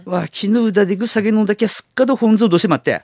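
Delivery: broad rural Tsugaru speech, fast and lively; thick Tsugaru dialect. Shown as a demonstration. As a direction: rattle it off in heavy Tsugaru dialect. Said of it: "例えばですね".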